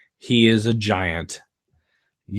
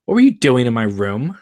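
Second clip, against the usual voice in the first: suspicious, paranoid; 3 semitones higher, 6 dB louder than usual. A narrator's reading. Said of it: "Laura, suspicion and paranoia".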